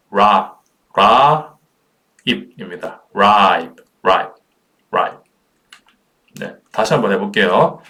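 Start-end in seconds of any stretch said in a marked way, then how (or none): 0.1-1.6 "라" held long and strong; isolated syllable demo. Shown as a demonstration. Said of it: "ripe 발음하실 때". 3.1-3.8 "ripe" stretched as raa-ip; sounded-out word. Shown as a demonstration. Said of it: "ripe 발음하실 때".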